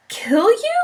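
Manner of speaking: high, disbelieving squeak; shocked squeal. Acted. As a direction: gasp out in horror. Wilbur shocked by news.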